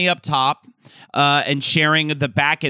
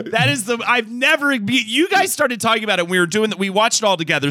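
ordinary talk, nothing marked

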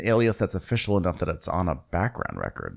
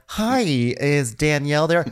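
high, drawn-out sales-call greeting; hammed-up cold caller; telemarketer; 7 semitones higher, slower than usual. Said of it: "They're like the telemarketer who's like".

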